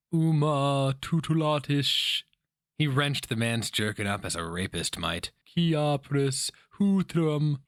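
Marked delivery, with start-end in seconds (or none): 0.1-2.2 slow, high, otherworldly chant of a spell word; sorceress incantation. A narrator's reading. Sorcerer hurling a spell in a tavern, slow eerie incantation. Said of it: "The first of the sorceress' words twisted sound". 5.4-7.6 slow, high, otherworldly chant of a spell word; sorceress incantation. A narrator's reading. Sorcerer hurling a spell in a tavern, slow eerie incantation. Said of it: "The first of the sorceress' words twisted sound".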